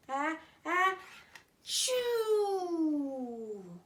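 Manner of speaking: loud, high, drawn-out sneeze; big bear sneeze. Acted. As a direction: build up the ah-ah, then burst out chew.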